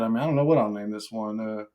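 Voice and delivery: usual speaking voice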